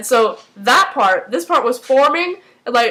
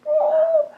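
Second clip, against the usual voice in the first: very high, drawn-out pitying coo; squeaky little moan of pity; sympathetic aww. Shown as a demonstration. Sympathy noise for crying Walter.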